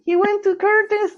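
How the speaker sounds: high, disbelieving squeak; incredulous. Retold or imitated. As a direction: act shocked someone got in. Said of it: "you're like".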